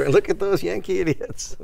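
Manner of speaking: high, loud gleeful jeer; mock gloating. Canadians watching American elections, mock gloating. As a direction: crow it high, as a joke.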